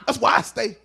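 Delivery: loud, emphatic declaration; defensive shouting. Acted. Gang member standing up for his street, defensive shout. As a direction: punch it out loud and proud.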